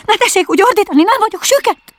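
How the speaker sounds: very high, loud scolding squawk; indignant Rabbit. A narrator's reading. Rabbit telling off the noisy caller, high indignant shout. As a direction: scold in a shrieky high character voice.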